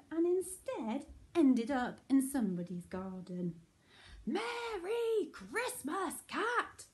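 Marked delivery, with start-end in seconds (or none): 4.3-6.8 high, drawn-out jolly call; big slow singsong holler; roared greeting. A narrator's reading. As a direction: bellow a festive greeting as Santa. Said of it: "roared Santa".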